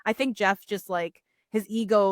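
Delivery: normal talking voice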